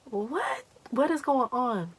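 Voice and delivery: high-pitched, acted bewilderment; bewildered reaction. Storyteller replaying her reply to the agitated friend, high and baffled.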